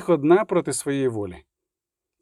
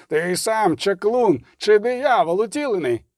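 high wailing accusation; howling priest; 6 semitones higher than usual. A narrator's reading. Priest accuses Regis of being the devil, howling. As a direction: howl it as a higher-pitched denunciation. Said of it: "завив жрець".

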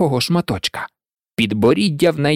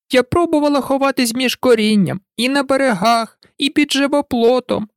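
higher, complaining bird voice; a weary mother pigeon griping; lightly voiced fretful pigeon; 9 semitones higher than usual. A narrator's reading. (скаргливий голос голубки)

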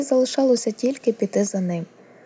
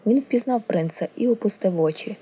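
ordinary delivery

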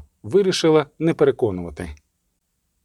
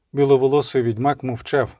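ordinary delivery, nothing marked

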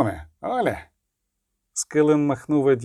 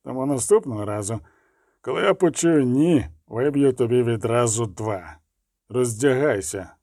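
cold; 2 semitones lower than usual. A narrator's reading. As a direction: threaten her coldly, without heat. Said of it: "сказав він холодно".

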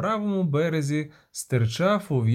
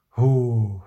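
low, drawn-out "phew"; relieved exhale; 5 semitones lower, 3 dB louder than usual. A narrator's reading. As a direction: blow out a breath of relief. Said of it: "витер лоба".

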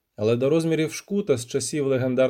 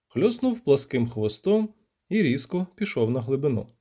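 ordinary delivery, nothing marked

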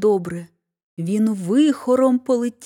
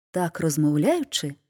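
ordinary delivery, nothing marked

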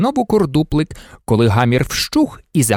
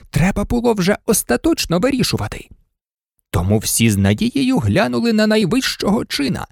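usual speaking voice

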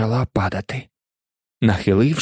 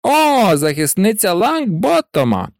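mocking; 7 semitones higher than usual. A narrator's reading. Pansy jeering at Parvati for defending a boy, mocking. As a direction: tease with a high, taunting lilt. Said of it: "глузливо мовила Пенсі Паркінсон".

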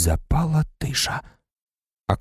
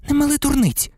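panicked. Acted. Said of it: "запанікувала Герміона".

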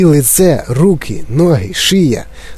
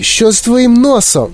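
much higher, alarmed question; shrieking; 10 semitones higher than usual. A narrator's reading. Mother stares at Violet's nose, shrieking. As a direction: cry out like a horrified mother. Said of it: "заверещала раптом пані Борегард".

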